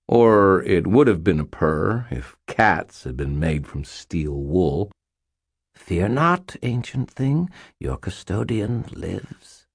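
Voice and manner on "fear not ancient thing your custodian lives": high, slow regal faerie queen; lightly voiced cold queen. A narrator's reading. Mab's voice.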